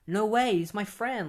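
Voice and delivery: can't believe it, lightly done; shocked disbelief. Retold or imitated. Barry hearing his friend is a killer, mildly mock shocked. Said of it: "Barry is just left shocked".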